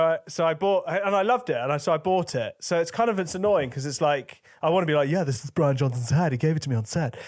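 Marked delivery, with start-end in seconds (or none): 5.1-7.2 show-off alter ego. Pretend. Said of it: "that was me. That was, like, my sort of alter ego".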